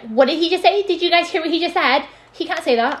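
mock outrage at a boy's joke, high and loud; mock outraged girl. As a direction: play the scandalised classmate over the top.

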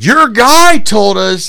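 high, loud, drawn-out heckle; retold shout. Somebody in the crowd passing the blame, imitated shout. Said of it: "somebody shouts out".

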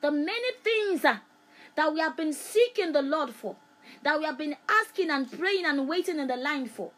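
loud high-pitched preaching burst; rising preaching. Acted. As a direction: lift the congregation with it.

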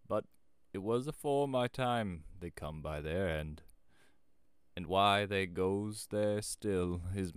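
slow, loud rustic tale-teller; ominous country storytelling, drawn out; old shepherd. A narrator's reading. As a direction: tell the local legend weightily.

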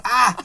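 loud, high yelp of pain; sudden loud "ah" of hurt; real pain yell. Real, not acted. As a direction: cry out from a stinging eye. Eye stinging from hot sauce.

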